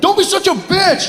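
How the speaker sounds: high-pitched mock whine of outrage; creepy guy turning nasty. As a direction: imitate the rejected creep's outburst. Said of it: "as soon as he's like".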